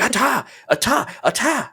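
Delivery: put-on high toddler voice repeating ata; squeaky little kid babbling; toddler babble. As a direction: mimic the baby's made-up word. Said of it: "And he's like".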